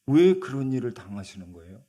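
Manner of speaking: Zacchaeus's question burst out loud; loud pleading question. Acted. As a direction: ask it loudly, as if pained by the news.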